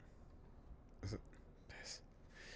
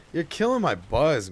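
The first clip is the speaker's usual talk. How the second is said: annoyed complaint; 6 semitones higher, 8 dB louder than usual. Real, not acted. Scolding game character.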